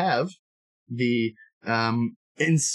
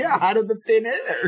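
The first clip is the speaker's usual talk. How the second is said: laughing; 10 semitones higher, 3 dB louder than usual. Real, not acted. Joining the laughing protest.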